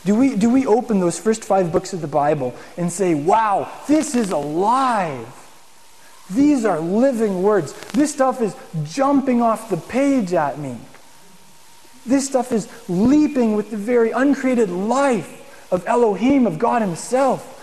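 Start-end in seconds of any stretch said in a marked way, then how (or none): 3.1-5.3 awed excitement. Pretend. Imagined reader thrilled by Torah. 6.3-7.7 slow, higher, wonder on "These are living words"; awed excitement. Pretend. Imagined reader thrilled by Torah. 7.9-17.5 slow, higher, marvelling at the text; awed excitement. Pretend. Imagined reader thrilled by Torah.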